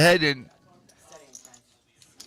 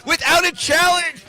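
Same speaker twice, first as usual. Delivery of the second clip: excited shout; 13 semitones higher, 7 dB louder than usual. Real, not acted. Big poker hand climax call.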